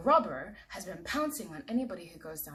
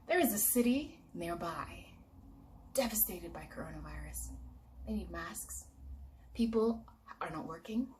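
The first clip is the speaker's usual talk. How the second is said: ordinary delivery, nothing marked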